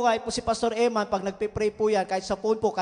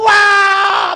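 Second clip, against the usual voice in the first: loud, high joyful cry; ecstatic shout; 8 semitones higher, 12 dB louder than usual. Retold or imitated. Telling the church of his bus miracle, a loud ecstatic wow. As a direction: burst out in Spirit-filled awe. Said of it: "nag-speaking in tongues po ako".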